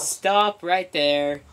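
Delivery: each word slow and firm; slow firm command. Real, not acted. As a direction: stop them cold, slowly. Shutting down spoilers.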